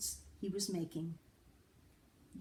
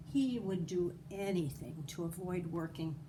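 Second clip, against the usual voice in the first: ordinary delivery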